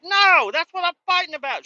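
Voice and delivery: high, loud mock exasperation; hammed-up screechy complaint; imitated angry yelling. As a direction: holler it as the fed-up coworker. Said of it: "I hear her in there screaming and yelling".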